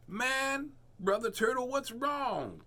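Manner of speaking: high groovy cat asking; high voice asking what's wrong; concerned Pete. Acted. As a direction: reach up high, caring cat. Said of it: "Pete asked".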